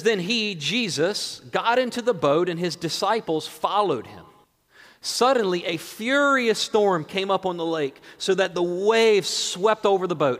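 preacher proclaiming the text; dramatic Scripture reading. Acted. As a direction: read the storm story out with weight. Reads Scripture aloud to congregation.

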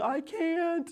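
put-on very high, drawn-out child voice; Glory. Father quoting three-year-old daughter, high mimicking voice. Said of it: "three-year-old Glory saying".

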